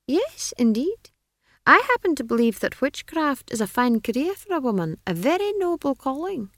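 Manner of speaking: high, condescending wizard voice; be an amused wizard humouring a child; lofty, high-pitched dismissal; patronizing wizard. A narrator's reading.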